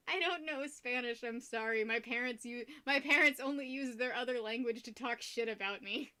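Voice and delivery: ordinary delivery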